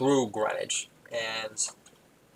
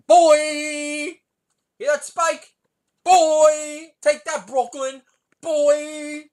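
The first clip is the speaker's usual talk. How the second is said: very high, loud crowing; stretched, over-the-top boasting yell; taunting shout; 9 dB louder, much slower than usual. Acted. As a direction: holler it in triumph at a rival. Triumphant taunting shout at Spike.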